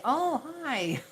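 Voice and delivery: high-pitched mock-delighted greeting; re-enacted excited reply. Replying to Thai people proud of their English, excited high greeting. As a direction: answer in a high, pleased lilt. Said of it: "And I'll say".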